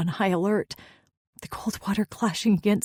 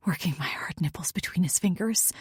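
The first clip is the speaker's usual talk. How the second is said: ordinary delivery, nothing marked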